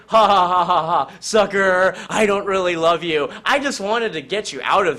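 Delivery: wife revealing her scheme to her husband, gloating; villainous gloating laugh. Acted. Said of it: "Yancey's like".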